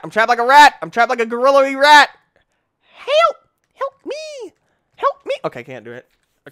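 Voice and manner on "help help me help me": very high squealing help; mock cry for help. Trapped in game, mock panic.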